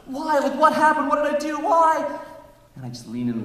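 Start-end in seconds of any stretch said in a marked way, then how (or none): 0.1-2.1 much higher, pleading whys; hammed-up distraught bride. Bride at the altar asks the groom why, high pleading. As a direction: play the jilted bride begging for an answer.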